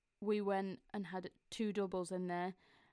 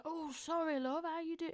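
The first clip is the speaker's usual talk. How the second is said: friendly local voice; 9 semitones higher than usual. Retold or imitated.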